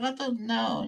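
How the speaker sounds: a hint of a timid little girl mumbling; scared little kid. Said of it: "She's like".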